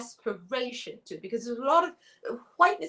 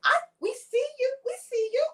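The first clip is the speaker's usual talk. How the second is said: I see you pitched way up and loud; high-pitched I see you; 11 semitones higher, 3 dB louder than usual. Shown as a demonstration. Host demonstrating the phrase to the stage, high-pitched and loud. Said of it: "can everyone please unmute and say".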